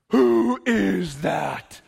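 very slow, stagey proclamation; hammed-up awe. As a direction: declaim it grandly, stretching every word. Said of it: "That's not how this plays out".

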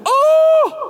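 high, thrilled oh; delighted gasp. Acted. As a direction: fan overwhelmed by the signature. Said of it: "and then you're like".

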